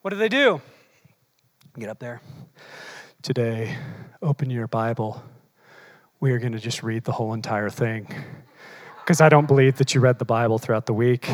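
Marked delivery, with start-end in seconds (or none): 1.8-11.3 a lowered mock preacher voice; mock lecturer. Preacher mocking a teacher type to the church, lowered voice. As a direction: play a droning teacher.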